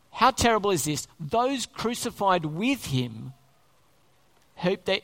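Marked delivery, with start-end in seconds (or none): none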